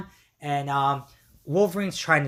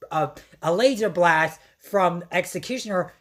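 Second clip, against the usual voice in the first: ordinary delivery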